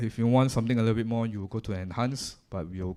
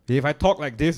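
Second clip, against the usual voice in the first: louder and higher, projecting hard; 5 semitones higher, 8 dB louder than usual. Shown as a demonstration. Teacher demonstrating loud talk into the mic. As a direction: push the voice up to show loud speech.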